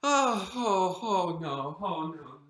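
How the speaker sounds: low, breaking into a sob; a low whimpering oh no; crying dismay. Acted. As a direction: let the dismay crack the voice.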